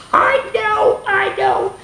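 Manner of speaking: very high squeaky "I know"; squeal. Real, not acted. Teacher squeaking at her own color joke. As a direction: squeak it out delighted.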